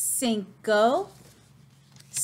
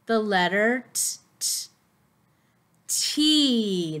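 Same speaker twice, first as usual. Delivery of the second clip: normal talking voice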